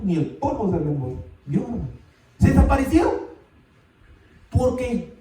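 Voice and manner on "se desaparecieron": "Se desaparecieron" shouted louder and higher; emphatic repeat. Shown as a demonstration. Preacher driving the point home to the congregation, emphatic shout. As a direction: punch "Se desaparecieron" out loud and high.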